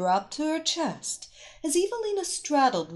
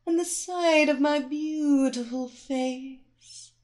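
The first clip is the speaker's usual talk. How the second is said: slow seductive hypnotic whisper-like voice; slower than usual. Acted. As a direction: pull him under slowly. Hypnotist lulling him deeper.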